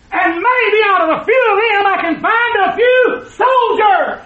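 building preacher's rallying shout; rousing preaching call. Real, not acted. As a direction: call soldiers to enlist with full force. Preaching at full force.

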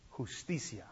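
low, faint, under the breath; hushed. Acted. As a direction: drop it to almost nothing. Whispered word of the wronged.

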